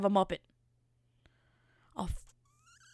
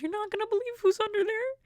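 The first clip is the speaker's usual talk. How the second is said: high put-on voice of the aunt; aunt quoted; 12 semitones higher than usual. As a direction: mimic the aunt, pitched way up. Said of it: "And she was like".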